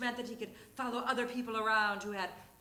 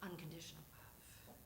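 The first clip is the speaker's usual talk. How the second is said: breathy whisper, dropping to nothing; 6 semitones lower, 17 dB quieter, much faster than usual. Acted. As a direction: hush it like a secret confession. Whispered punchline about monkey love.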